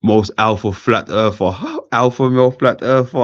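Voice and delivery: chuckling disbelief; laughing. Real, not acted. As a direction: read it out while cracking up. Laughing at the name.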